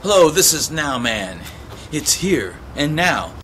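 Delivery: loud, punchy announcer voice as Now Man; Now Man character. Acted. Performer introducing his Now Man character, big booming announcer voice. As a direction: boom out the character's intro like a showman.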